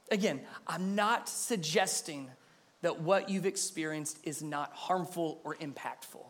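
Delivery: ordinary delivery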